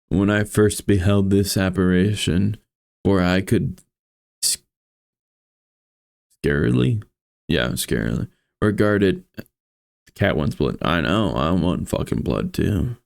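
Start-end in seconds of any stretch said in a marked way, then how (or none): 0.1-9.2 measured, somber storytelling read; story narration. A narrator's reading. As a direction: read it like a gothic tale. Reads Poe story aloud.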